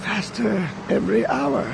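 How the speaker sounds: put-on quiet old man foreboding; ominous old man voice.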